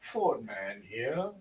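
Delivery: lightly deepened, slow radio voice; deep Fordman voice. Retold or imitated. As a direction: drop the voice low and drawl it out. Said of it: "I like the way he does that".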